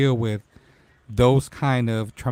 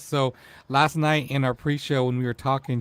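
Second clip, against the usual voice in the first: normal talking voice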